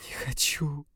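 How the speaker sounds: pitiful whispered plea; woman pleading weakly in a whisper; plaintive whisper. A narrator's reading. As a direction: breathe it out pitifully. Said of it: "жалобно".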